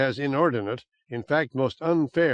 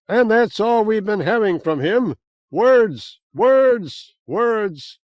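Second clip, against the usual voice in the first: mocking taunt; 8 semitones higher, 6 dB louder, slower than usual. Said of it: "His voice chuckled off".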